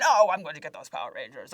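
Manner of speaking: loud, fast villain threat; villain on the phone to kids, loud threat. Retold or imitated. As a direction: threaten the heroes with gleeful menace. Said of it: "call as Diva Talks".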